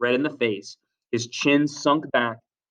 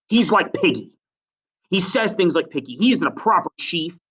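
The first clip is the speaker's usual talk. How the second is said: raised, higher voice, pushing on; higher-pitched, determined accusation; tremulous yet determined; 7 semitones higher than usual. A narrator's reading. Jack pushes against the silence, voice raised and determined. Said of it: "Jack's voice went up, tremulous yet determined".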